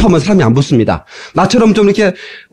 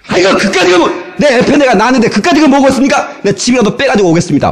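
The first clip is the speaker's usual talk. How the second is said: high-pitched burst easing down; animated, impulsive promise; eager caller; 7 semitones higher than usual. Retold or imitated. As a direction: imitate a caller brushing off the cost with gusto. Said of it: "막 그러죠".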